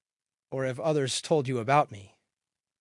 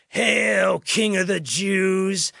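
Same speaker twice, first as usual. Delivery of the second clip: louder, higher, drawn-out salute; mocking hail; 6 semitones higher, 7 dB louder, much slower than usual. A narrator's reading. Soldiers hailing Jesus over and over, loud mocking call. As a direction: call it out as a mock tribute.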